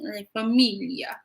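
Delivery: familia in a hammed-up, bad Italian accent; a half-hearted Godfather-style Italian; mock Italian accent. Shown as a demonstration. Tarot reader jokes about the Godfather deck, in a bad mock Italian accent. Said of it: "I can't do an Italian accent if I try".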